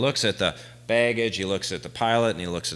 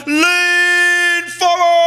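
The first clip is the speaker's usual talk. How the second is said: slow, drawn-out yell, much higher and louder; 13 dB louder, much slower than usual. Retold or imitated. Teller yells to John during takeoff, a slow, high, loud yell. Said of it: "I yell to John".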